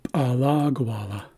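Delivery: Alagwala as pa-LA-gwa-la, popped P first; second-syllable stress. Shown as a demonstration. Teacher explains the accent marker, stress on second syllable. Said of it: "the accent comes after the second syllable".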